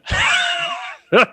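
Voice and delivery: high, burst-out laugh at his own punchline; real laugh after the payoff; laughing as the story lands; delighted laugh. Real, not acted.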